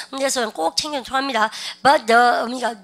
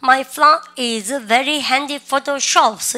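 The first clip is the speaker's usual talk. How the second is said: very slow, loud model sentence; slow drill read; 5 dB louder, much slower than usual. Shown as a demonstration. Teacher leading the class reading slowly. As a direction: read it loud and drawn out for repetition. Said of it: "시작".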